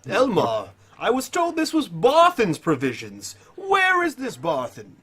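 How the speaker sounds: pompous, high-pitched demand, slowing at the end; Tiberius. Acted. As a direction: question him grandly in a flamboyant voice. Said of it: "it's Tiberia's voice".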